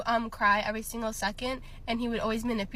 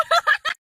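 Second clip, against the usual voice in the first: shrieking laugh; 10 dB louder than usual. Real, not acted. Laughs after outrage.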